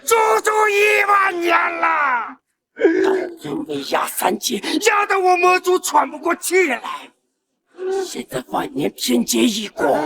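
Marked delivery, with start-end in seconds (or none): 0.0-6.8 triumphant shouting. Acted. Demon lord's triumphant rant.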